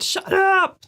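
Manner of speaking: high-pitched mock yell; imitated shout. Quoting Vicky shouting at barking dogs, high mock shout. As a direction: imitate someone yelling, pitch jumping up.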